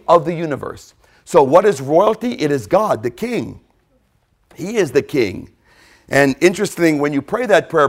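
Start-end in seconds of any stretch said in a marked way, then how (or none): none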